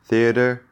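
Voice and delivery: crisp th in theater; native th. Shown as a demonstration. Teacher models native theater again with th. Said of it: "So you're listening".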